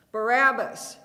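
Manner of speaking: one loud bellowed name. A narrator's reading. Mob answering the governor, loud bellowed cry. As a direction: roar it like a whole crowd. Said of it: "it bellowed".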